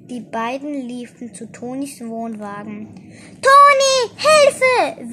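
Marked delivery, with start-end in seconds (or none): 3.4-5.1 very high, loud cry for help; wailed plea. A narrator's reading. Ette begs Toni for help, loud high wail. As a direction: cry out desperately at a high pitch. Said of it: "wimmerte Eze".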